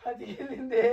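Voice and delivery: high, soft fake whimper; mock crying. Man complains she pinched him, mock crying. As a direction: whine like a tattling child, half laughing.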